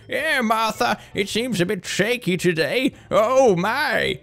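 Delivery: high put-on voice of an old retiree, unbothered. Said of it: "when I'm old and retired".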